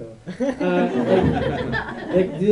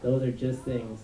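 lightly voiced soothing family member, softer and slow; a hint of gentle reassurance; mock comforting; 6 semitones lower, 9 dB quieter, slower than usual. Comic quoting family after a robbery, softly reassuring. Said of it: "comforting thing they say".

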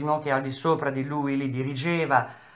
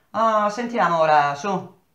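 higher, louder mocking dare; taunting challenge; 6 semitones higher, 5 dB louder than usual. A narrator's reading. Old Lippi taunts the engineer, daring him. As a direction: goad him to speak.

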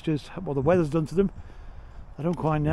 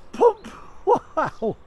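delighted surprise; 13 semitones higher, 4 dB louder than usual. Real, not acted. Spotting a Texaco pump.

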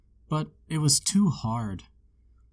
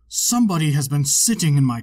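a louder, big papa bear boom; great big bear voice; 7 dB louder, faster than usual. A narrator's reading. Papa bear finds his porridge eaten, booming big bear voice. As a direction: make the biggest bear rumble his complaint. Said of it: "in his great big voice".

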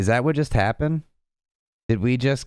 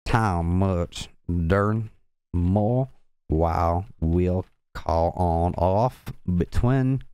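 very slow Southern accent, word by word; halting, drawn-out Southern accent; 3 semitones lower, much slower than usual. Shown as a demonstration. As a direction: read a word list in a deliberate Southern drawl. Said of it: "trying to do this in the southern accent".

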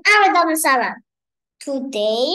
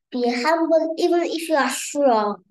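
ordinary delivery

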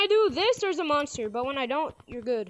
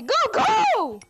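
shouting; 9 semitones higher, 5 dB louder than usual. Real, not acted. Urging a player in a race.

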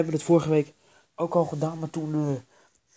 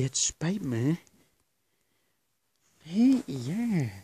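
usual speaking voice

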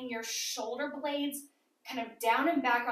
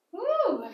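cheerful whoop; 13 semitones higher, 5 dB louder than usual. Real, not acted. Cheering after a hard set.

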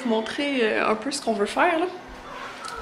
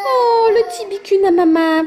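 squeaky praising a dog; 9 semitones higher, 6 dB louder than usual. Real, not acted. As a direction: gush over the little one. Mom cooing, praising the baby.

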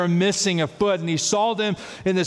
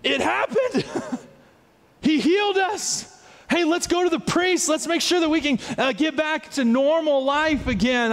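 high, overjoyed and breathless; joyful disbelief; 9 semitones higher than usual. Retold or imitated. Healed lepers celebrating, excited and high. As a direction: burst out with happy relief. Lepers rejoice over healing.

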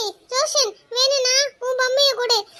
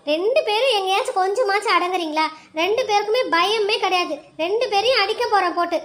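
lower, scolding elder; stern adult; 5 semitones lower than usual. Acted. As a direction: threaten the two kids sternly. Adult threatens both kids. (இரண்டு பிள்ளைகளையும் மிரட்டும் பெரியவர்)

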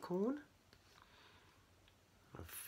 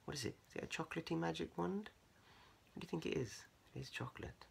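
soft aside; 6 semitones higher, 12 dB quieter, much faster than usual. Real, not acted. Quiet asides to the child.

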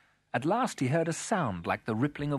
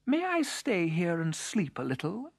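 lightly voiced beetle, a little higher; a hint of a polite little beetle making a request; gently lifted character voice; beetle asking politely; 7 semitones higher than usual. A narrator's reading. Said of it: "asked the beetle".

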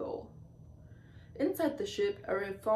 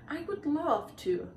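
mildly excited, higher pitch; exclaiming; 7 semitones higher than usual. A narrator's reading. Young mermaid invited to see a collection, lightly exclaiming. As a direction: accept with a lift of delight. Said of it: "Coral exclaimed".

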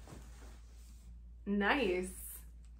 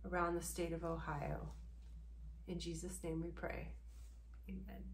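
soft, hushed mock prayer; a hushed tongue-in-cheek prayer; 7 dB quieter than usual. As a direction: pray earnestly, half joking.

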